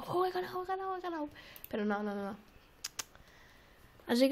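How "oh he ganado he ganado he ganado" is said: mock triumph.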